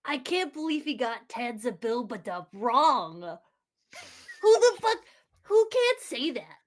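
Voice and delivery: rising into an outraged shout; can't believe anyone botched the name, yell it; outraged disbelief. Real, not acted. Player fumes at the GM for botching the name, outraged shout.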